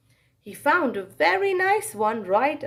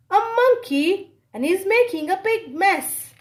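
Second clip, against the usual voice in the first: high, loud startled cry; shocked nurse; 8 semitones higher, 5 dB louder than usual. Acted. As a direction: gasp it out as the nurse spots the monkey.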